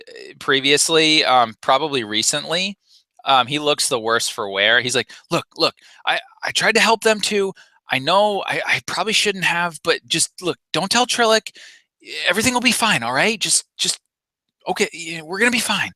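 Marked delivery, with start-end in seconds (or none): 5.3-15.9 high, rattled babbling; freaking out. Acted. Panicked Rhodian begs them not to tell Trillic. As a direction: talk fast to calm everyone, scared. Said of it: "he's freaking out".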